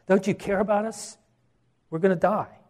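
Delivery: alarmed pleading. Acted. Disciples' plea to Jesus in storm.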